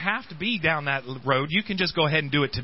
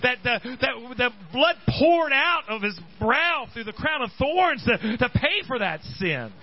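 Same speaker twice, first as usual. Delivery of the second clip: raised, ringing preacher's cry; fiery preaching; 8 semitones higher than usual. Acted. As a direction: preach it out to the congregation. Preacher building to a climax.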